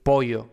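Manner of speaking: "pollo" with a weak glide in the middle; anglophone lax Y. Shown as a demonstration. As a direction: soften the ll of "pollo" to an English y glide. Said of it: "los anglófonos van a tender a decir pollo".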